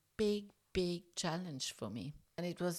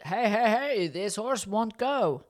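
retold shout; 4 semitones higher, 8 dB louder than usual. Calling across to Fabio that the horse won't move, loud and high. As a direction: call out loudly across the field. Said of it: "shouted out to Fabio".

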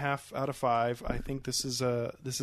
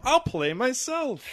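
pompous actor; 12 semitones higher, 6 dB louder, slower than usual. Retold or imitated. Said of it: "pompous ass".

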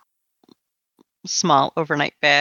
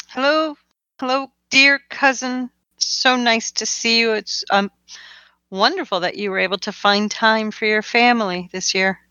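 higher, sugary polite voice; sweetly false greeting; 4 semitones higher than usual. Acted. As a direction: greet him with fake sweetness. Edith greets cousin in character.

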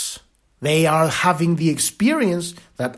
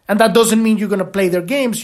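ordinary delivery

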